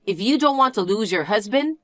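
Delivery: ordinary delivery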